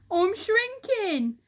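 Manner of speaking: high, loud, slow terrified cry; Mister Twit realising he shrinks; high panicky wail; panicked burble. Acted. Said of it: "burbled Mister Twit".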